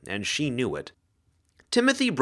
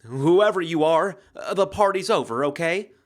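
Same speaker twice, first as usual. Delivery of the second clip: failing to sound commanding; 6 semitones higher, 4 dB louder than usual. A narrator's reading. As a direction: fake toughness that cracks. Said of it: "trying and failing to sound commanding".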